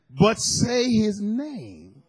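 drawn out slowly, word by word; slow emphasis. Real, not acted. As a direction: lean on each word slowly. Preacher's emphatic point.

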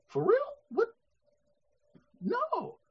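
high, hushed disbelief; incredulous. Retold or imitated. Funeral guest hearing mourners berated for crying, incredulous. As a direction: squeak it out softly, taken aback.

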